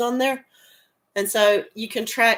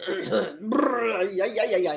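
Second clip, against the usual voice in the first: brr with chattering yeahs; play-shiver at the cold; hammed-up shivering noise; mock shiver; slower than usual. Reacting to minus forty-five weather.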